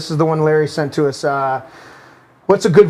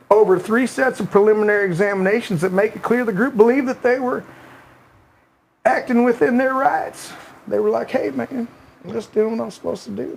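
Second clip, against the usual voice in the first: Bill Clinton voice; 3 semitones higher than usual. Retold or imitated. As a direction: read the article as a mild Clinton impression with a Southern accent. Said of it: "in the Clinton voice".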